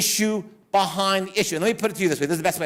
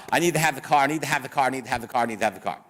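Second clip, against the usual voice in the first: repetitive plea; 5 semitones lower, much faster than usual. Retold or imitated. Client pleading with her lawyer for the car, repetitive and fading. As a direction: rattle off the plea, fading down to a mumble.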